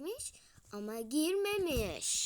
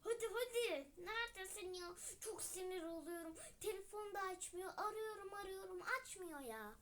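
climbing stairs; 4 semitones higher, 12 dB quieter than usual. Acted. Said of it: "O yüzden sesim böyle geliyor".

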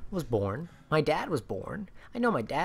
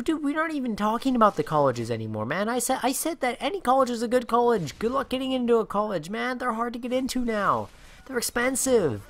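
higher, raised voice, fed up and pleading; as if still stuck in the twenty minute rant; retold exasperated reply; 9 semitones higher, 4 dB louder than usual.

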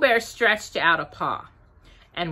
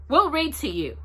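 high, cheerful offer; 7 semitones higher than usual. A narrator's reading. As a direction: offer it brightly, pitched up. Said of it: "said Rabbit cheerfully".

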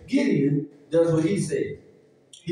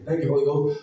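normal talking voice

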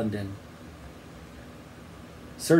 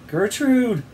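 high-pitched anxious call; high call, moderately raised; boy's cry; 9 semitones higher, 4 dB louder than usual. A narrator's reading. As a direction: cry out to a frightened friend. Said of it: "cried the boy".

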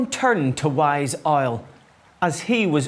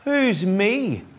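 mildly cross, higher-pitched question; storybook bird asking crossly; a touch of indignation, pitched up; cross robin; 7 semitones higher than usual. A narrator's reading. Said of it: "asked cross Robin".

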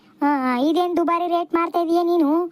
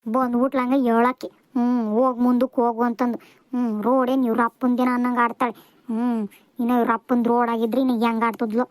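low-pitched resentful grumble; low, disgruntled aside; grumbling; 7 semitones lower than usual. Acted. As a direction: gripe to yourself about the bossy seller. Snubbed customer grumbles to herself.

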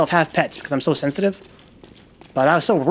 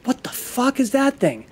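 higher, slow, stunned question; staring at a huge bug in disbelief; drawn-out shocked what-the; 7 semitones higher, slower than usual. Retold or imitated. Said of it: "I'm like".

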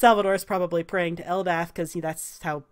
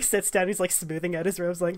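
ordinary talk, nothing marked

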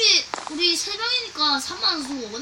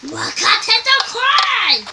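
높고 느리게 기술 이름 외치기; 필살기 외치듯; 7 semitones higher, slower than usual. Acted. (기술 외치는 목소리)

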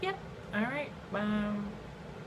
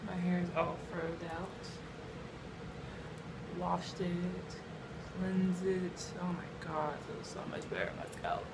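ordinary delivery

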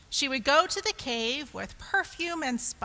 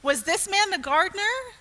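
confused; 7 semitones higher, 5 dB louder, faster than usual. A narrator's reading. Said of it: "Let me see a confused face".

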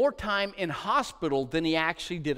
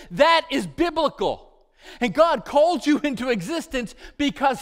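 louder, higher preaching at full force; fiery preaching; 5 semitones higher, 6 dB louder than usual. Acted. Preacher pressing the point to the congregation, at full force.

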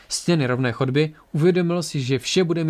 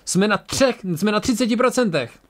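higher, urgent alarmed cry; angry yell; 7 semitones higher than usual. A narrator's reading. Tora reports failing shields to the crew, yelling angrily. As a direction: keep yelling at a raised pitch. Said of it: "zařvala naštvaná Tora".

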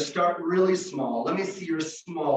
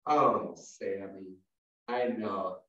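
low, slow consoling; drawn-out, low pretend commiseration; mock sympathy; 12 semitones lower, 5 dB quieter, slower than usual. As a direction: gently console a little snail. Consoling the slow pretend-fast snail.

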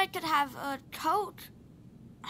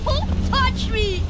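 loud, slow, raised rebuff; angry shout; 4 semitones higher, 8 dB louder, slower than usual. Acted. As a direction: shout him away from you. Boy fends off brother.

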